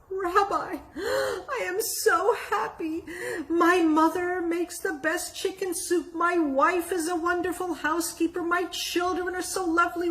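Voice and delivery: high, giddy, gushing thanks; gushing joy. Acted. Happy man thanking the rabbi, high gushing joy. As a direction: beam it out like pure relief.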